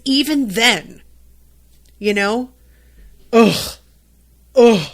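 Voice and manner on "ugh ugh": loud fed-up groans; disgusted ugh. Real, not acted. Venting to viewers, a loud disgusted ugh.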